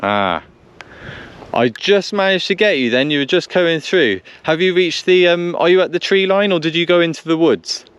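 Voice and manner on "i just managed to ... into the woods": normal talking voice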